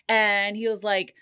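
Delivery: usual speaking voice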